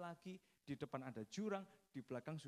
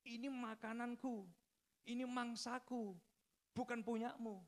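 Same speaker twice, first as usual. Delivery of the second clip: acted anger in a raised pitch; angry tiger; 6 semitones higher than usual. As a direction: warn off a rival like a possessive tiger. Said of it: "marah harimaunya, saudara".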